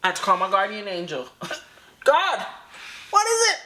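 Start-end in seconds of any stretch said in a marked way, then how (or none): 2.0-3.6 high-pitched, squeaky appeal; hammed-up panic, voice pitched way up; mock alarm. Listener calling on a guardian angel, mock alarm.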